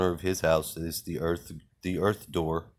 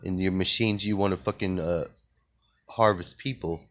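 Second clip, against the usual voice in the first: ordinary talk, nothing marked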